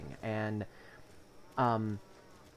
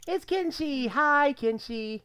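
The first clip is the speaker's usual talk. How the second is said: excited greeting; 13 semitones higher, 7 dB louder, slower than usual. Real, not acted. Greeting the cat on stream.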